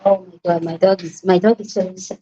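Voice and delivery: ordinary delivery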